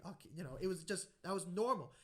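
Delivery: ordinary talk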